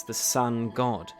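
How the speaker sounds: ordinary talk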